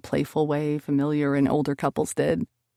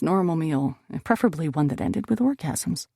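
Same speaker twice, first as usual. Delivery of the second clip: usual speaking voice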